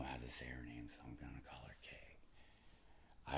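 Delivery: slow whispered aside. Real, not acted. To the audience about a hard name, whispered. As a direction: drop to a whisper to share the nickname.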